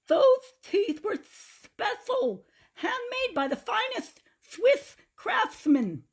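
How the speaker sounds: put-on high, slow, lisping old man voice; toothless Grandpa. A narrator's reading. Grandpa mourns his stolen teeth, high toothless lisp. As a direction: lisp through a mouth with no teeth. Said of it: "Why is Grandpa talking funny?".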